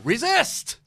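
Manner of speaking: high, rising protest chant; mock protest cry. Rallying the group chat with a high, loud slogan.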